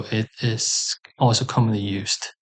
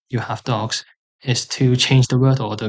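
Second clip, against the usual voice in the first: usual speaking voice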